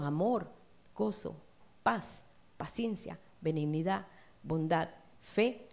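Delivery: usual speaking voice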